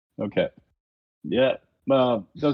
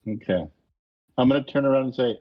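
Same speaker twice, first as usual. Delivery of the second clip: ordinary delivery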